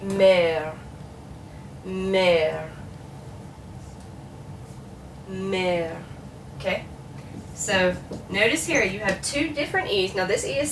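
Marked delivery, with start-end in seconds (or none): 0.0-6.0 "mère" drawn out, sinking slightly; grave accent, going down. Shown as a demonstration. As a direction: hold the open EH in "mère", letting it settle. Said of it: "like it's going down".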